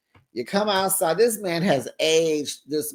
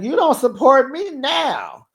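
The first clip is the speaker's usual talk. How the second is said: higher, louder, hammed-up retort; mock indignant Julius; 9 semitones higher, 5 dB louder than usual. Retelling Julius snapping back at his girlfriend, higher and louder. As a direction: toss the line back like the cocky boyfriend. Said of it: "He was like".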